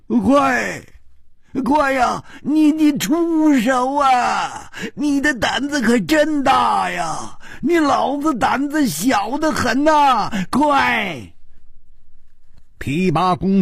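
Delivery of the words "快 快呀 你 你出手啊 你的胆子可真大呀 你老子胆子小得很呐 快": high, drawn-out cowardly wail; loud frantic urging. A narrator's reading. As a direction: shout for help in a panic, stretching the words. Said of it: "大声地说". (高声拖长着催喊)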